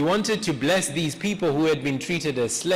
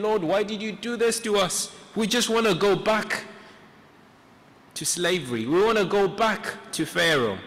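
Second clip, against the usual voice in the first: higher-pitched plaintive cry, without real tears; imitated crying plea; 5 semitones higher than usual. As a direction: act out a people wailing to God. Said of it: "they're crying, saying".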